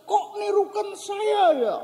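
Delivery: put-on very high kid's protest; high-pitched imitated kid's complaint; child calling out. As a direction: imitate a puzzled child calling across a ravine. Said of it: "Eh, terus dia ngomong".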